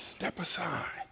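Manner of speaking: soft, slow whisper. Real, not acted. Preacher closing the sermon for the congregation, whispered slowly. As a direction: breathe it gently, almost silent. Preacher urging quiet surrender.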